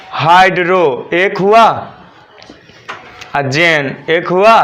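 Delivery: Hydro, long pause, then जेन; syllable by syllable. Shown as a demonstration. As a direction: slowly count off hydro and जेन as separate chunks. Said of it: "syllable पर चलूँगा". (Hydro और जेन को खंडों में तोड़कर, धीरे)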